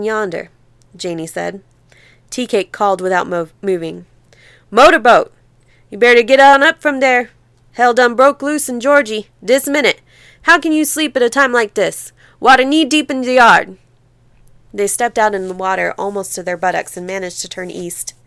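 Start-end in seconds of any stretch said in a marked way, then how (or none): 4.7-13.8 loud, urgent calling out to wake a sleeper; urgent shout. Acted. Tea Cake shouting to Motorboat to wake up, loud and urgent. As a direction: holler him awake, the flood is here.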